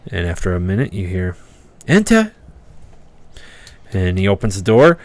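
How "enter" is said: calling enter. Acted. Wizard calls through door.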